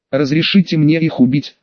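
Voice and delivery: ordinary delivery, nothing marked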